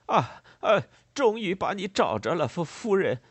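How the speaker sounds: loud, high-pitched agitated stammer. A narrator's reading. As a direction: stumble over words in frantic relief. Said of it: "他结结巴巴地说道". (激动地结结巴巴)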